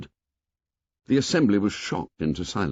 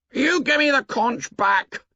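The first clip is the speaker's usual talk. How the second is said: demanding shout. A narrator's reading. Tussle over the conch.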